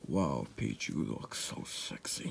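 very low, soft, slow admiration; smitten murmur. Acted. Admiring Peach in the trailer, smitten murmur. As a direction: half-whisper it, dropping way down.

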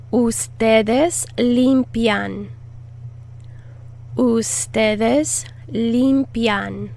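ustedes limpian said slowly and clearly; slow teaching model. Shown as a demonstration. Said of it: "teacher models conjugation".

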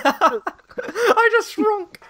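high squeaky giggle, very slow; laughing. Real, not acted. Laughing at herself.

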